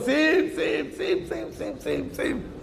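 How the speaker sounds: shouted high, then fading to a whisper; escalating pleas. Retold or imitated. Worshipper begging Hashem for everything, from a high shout down to a whisper.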